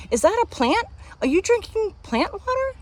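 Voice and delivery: very high, mock-appalled questioning; exaggerated disbelief; incredulous coworker.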